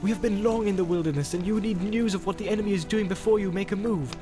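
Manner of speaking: persuasive urging. A narrator's reading. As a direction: coax a reluctant friend along. Boromir urges Frodo to come along.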